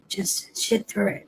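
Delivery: put-on high, slow, soft young recruit; hushed urging. Kid telling him to stay put, high hushed voice. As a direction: imitate a kid urging under his breath.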